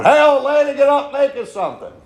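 loud, high bellow to wake the wife; drunk husband hollering. Acted. Drunk husband coming home at night to his sleeping wife, bellowing orders. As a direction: holler through the apartment, rude and demanding.

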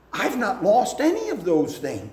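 high, indignant protest. Retold or imitated. Church man protesting to pastor, high and indignant. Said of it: "the man finally spoke up and protested".

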